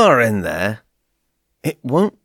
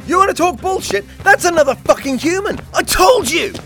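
fast, high heated yelling; angry; 6 semitones higher, faster than usual. Acted. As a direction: yell back, furious. Heated argument in car.

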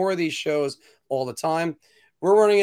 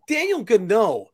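ordinary talk

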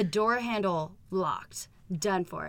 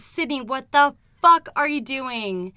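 slow, louder mock-outraged demand; retold outrage; 4 semitones higher, 9 dB louder than usual. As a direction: stretch it out loudly, imitating an outraged boyfriend. Acting out his shocked confrontation.

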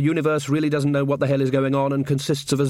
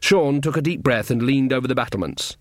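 ordinary talk, nothing marked

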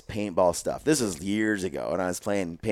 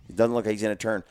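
normal talking voice

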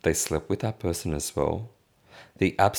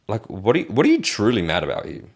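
normal talking voice

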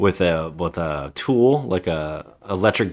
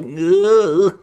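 high, louder groan of disgust; disgusted ugh; 12 semitones higher, 7 dB louder than usual. Retold or imitated. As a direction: groan like a grossed-out kid. Boy reacts in movie retelling.

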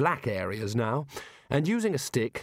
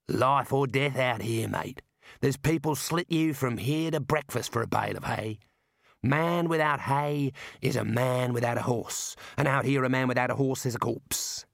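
Australian Mad. Acted. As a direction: lay down the law like a bushman.

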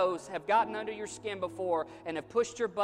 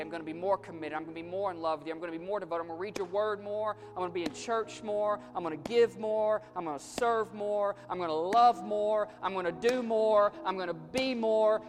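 earnest vow, building; 3 semitones higher, 4 dB louder than usual. Acted. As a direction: start plain, then pile up each pledge more fervently. Acted prayer of escalating vows.

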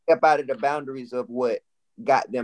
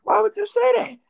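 shocked challenge; 4 dB louder than usual. Real, not acted. Challenging what he just said.

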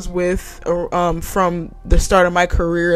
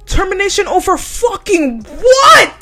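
high, loud, slow disbelieving yell; outraged shout; 10 semitones higher, 4 dB louder, slower than usual. Retold or imitated. Worker yelling at team lead over a threatened termination, outraged. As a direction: spit it out in outrage.

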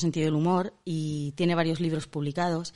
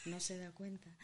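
distant, much softer off-mic voice; moving off mic; 8 dB quieter than usual. Shown as a demonstration. Host shows how she hides poor English, backing off mic. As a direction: fade away from the microphone to hide bad English. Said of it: "es así apartarme del micro".

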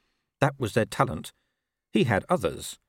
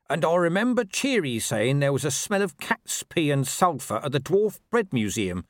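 higher-pitched watchman character; reporting watchman Reg; 7 semitones higher than usual. Acted. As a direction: report the clue in a raised voice.

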